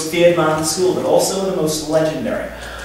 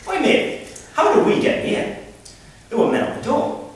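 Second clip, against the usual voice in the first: higher-pitched Geordie voice; Geordie accent; 11 semitones higher than usual. Acted. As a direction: play a puzzled Geordie lad, pitched up. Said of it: "conversation between a Geordie and his".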